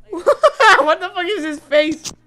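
laughing at the character's face. Real, not acted. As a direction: laugh in disbelief.

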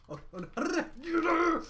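long high wail of no; dread. Real, not acted. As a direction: drag out the dread. About to take hot sauce.

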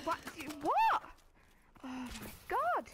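loud outraged yell; raging gamer. Real, not acted. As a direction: shout at the screen in disbelief. Gamer getting killed, yelling.

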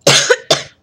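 sudden loud choking cough; coughing fit. Real, not acted. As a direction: let the held-in cough burst out. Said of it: "I wasn't holding it anymore".

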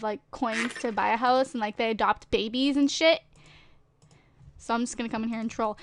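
ordinary talk

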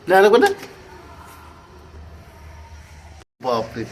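put-on high, loud baby talk to a pet; high coaxing pet voice. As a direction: sweet-talk the cat. Coaxing and praising the cat.

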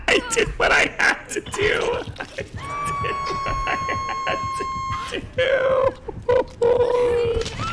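high, stretched falsetto cry; hammed-up tragic defense; mock dramatic wail.

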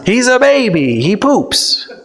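normal talking voice

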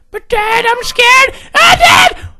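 put-on very high, loud child voice; scared little son. Son telling Dad he's scared, squeaky and panicked. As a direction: squeal it like a frightened kid.

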